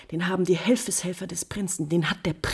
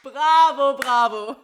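loud, high, laughing cries of bravo; mocking laughter, loud and high; bitter laughing bravo; 6 semitones higher, 8 dB louder, slower than usual. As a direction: applaud with a bitter, manic laugh. Countess's bitter mock applause.

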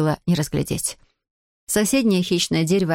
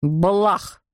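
alien creature spitting a syllable; curt creature exclamation; spat-out creature word. A narrator's reading. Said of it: "выплюнуло существо".

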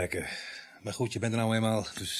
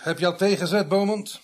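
calling out; 8 semitones higher, 6 dB louder than usual. Acted. Calling out to the constable.

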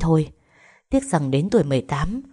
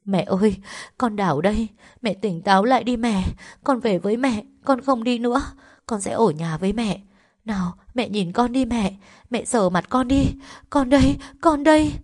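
crying and pleading; 5 semitones higher than usual. Acted. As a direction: pitch climbing higher, voice breaking into tears. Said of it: "vừa khóc vừa van xin".

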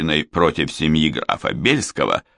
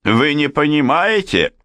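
higher-pitched, spiteful retort; spiteful snort; 9 semitones higher than usual. A narrator's reading. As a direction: throw it back with a touch of malice. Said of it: "злобно фыркнула арестованная". (злобно, с повышением тона)

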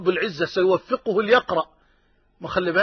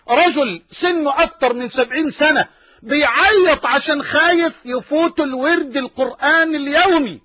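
raised, emphatic preacher's voice; impassioned preaching; 4 semitones higher than usual. Real, not acted. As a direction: marvel at it, pitch lifted and words stretched. Preacher marvels at weeping old man. (وعظ حماسي بنبرة متعجبة)

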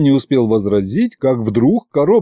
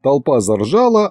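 ordinary delivery

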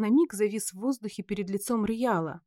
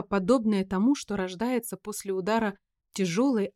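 ordinary delivery, nothing marked

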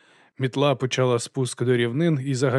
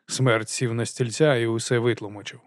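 ordinary delivery, nothing marked

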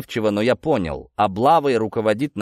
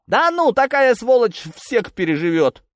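high, exclaimed brush-off; raised, indignant policeman's voice; scornful dismissal; 13 semitones higher, 4 dB louder than usual. Acted. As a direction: wave the worry away with contempt.

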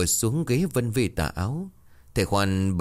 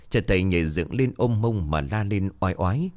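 usual speaking voice

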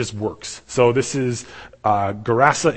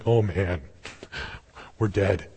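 low, softer, sinking realization; deflated dread; 5 semitones lower, 5 dB quieter, slower than usual. Retold or imitated. Disciples realize who calmed the storm, deflated dread. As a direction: let it drop with a small laugh.

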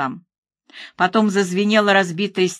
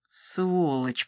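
бормотание; 2 semitones lower, 8 dB quieter than usual. A narrator's reading. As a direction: mutter it under your breath. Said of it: "пробормотала Кот, боясь услышать в своём голосе". (тихо пробормотать ругательство)

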